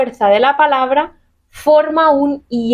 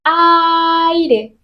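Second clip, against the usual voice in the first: Aire, alto y marcado en la A; stress on aire; 6 semitones higher than usual. Shown as a demonstration. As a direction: decir Aire cargando la fuerza en la A. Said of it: "cuál es la vocal que recibe la fuerza". (acento en la A de Aire)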